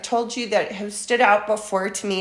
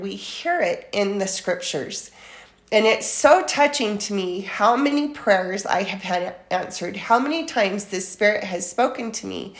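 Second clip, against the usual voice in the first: ordinary delivery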